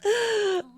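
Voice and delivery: short high laugh. Real, not acted. Streamer amused by a chat joke, high burst of laughter.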